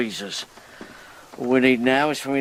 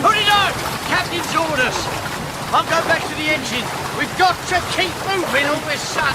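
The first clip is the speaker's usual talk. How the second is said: much higher, barked command; urgent order shout. Acted. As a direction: order her, no arguing. Ordering her into life buoy.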